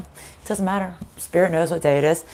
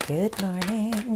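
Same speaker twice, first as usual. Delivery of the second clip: slow, softer stretched greeting; sleepy slow good morning; slow warm-up; 2 semitones higher, 8 dB quieter, much slower than usual. Shown as a demonstration. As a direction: drag it out to loosen the voice. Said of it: "I really need to warm up".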